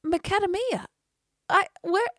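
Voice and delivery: high, baffled repeat; mock bewilderment. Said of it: "And I'm like".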